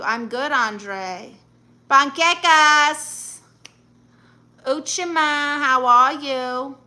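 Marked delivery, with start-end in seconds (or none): 1.9-6.8 loud, drawn-out, higher-pitched hello; calling out greetings. Real, not acted. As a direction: call out slowly and loudly to arriving viewers.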